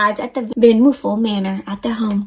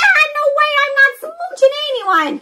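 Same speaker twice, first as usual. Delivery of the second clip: scared refusal. Acted. Bruce refuses the kiss.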